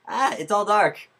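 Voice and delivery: high, loud cry of alarm; startled exclamation. Pretend. Speaker reacting as everything goes dark, startled exclamation. Light suddenly changes to dark.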